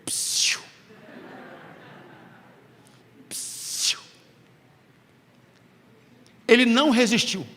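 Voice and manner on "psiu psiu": whispered psst, beckoning; the apples softly calling psst; tempting psst. Retold or imitated. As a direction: hiss a soft psst to lure him in. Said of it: "as maçãs fizeram assim pra ele".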